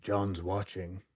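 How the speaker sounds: creepy radio voice. Retold or imitated. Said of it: "And it just said".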